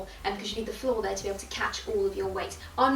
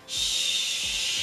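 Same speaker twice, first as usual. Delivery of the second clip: long hiss opening into a vowel; shh releasing to ah. Shown as a demonstration. Stomach voice warm-up, long shh released. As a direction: hold the shh, then let it out. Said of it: "release on an R".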